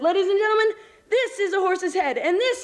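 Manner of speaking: high circus announcer voice; head clown announcing. Acted. Head clown sets up his gag for the crowd, announcing. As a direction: announce it to the whole big top. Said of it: "the clown says".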